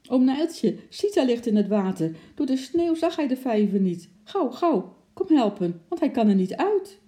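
high, loud panicky plea; urgent alarm. A narrator's reading. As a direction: raise the alarm and beg them to hurry. Child urgently begs keepers for help.

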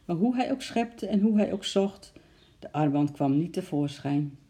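ordinary delivery

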